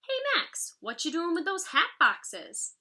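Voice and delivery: high, bright call to a friend; playful children's voices; kids calling out. A narrator's reading. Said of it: "Cindy, Sean, and Jamal showed up".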